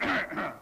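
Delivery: a pointed, attention-seeking ahem; loud throat clearing. Retold or imitated. Actor wants the fan to notice him: loud pointed throat clear. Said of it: "So I cleared my throat".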